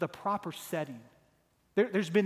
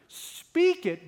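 louder, higher sermon climb, pressing each line; impassioned preaching; 5 semitones higher, 5 dB louder than usual. Acted. Preacher building to a climax about the church's casualties, impassioned. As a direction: preach it hard, building to a peak.

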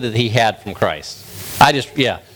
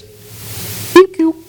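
tiny, very high squeak through puckered lips; pursed-mouth frog; 3 dB louder than usual. Acted. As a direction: shrink the frog down to a little squeak. Frog hiding its wide mouth.

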